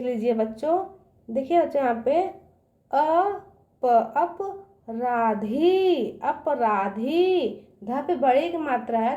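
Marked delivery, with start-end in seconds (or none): 4.2-7.6 अपराधी slowly, with the final धी stretched; long final ee. Shown as a demonstration. Teacher reading the word for children, अपराधी slow with long ending. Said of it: "इसका उच्चारण दूर तक जाएगा".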